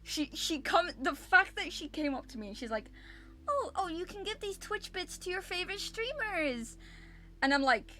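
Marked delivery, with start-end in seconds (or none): 3.5-6.8 high-pitched mocking imitation; mock staff lady. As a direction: parody her clueless offer in a high voice. Mocks staff lady's tone-deaf offer.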